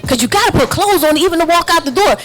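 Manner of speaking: ordinary delivery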